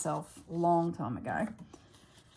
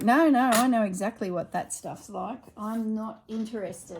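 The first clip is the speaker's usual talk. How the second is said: emphatic refusal; 4 semitones higher, 8 dB louder than usual. Retold or imitated.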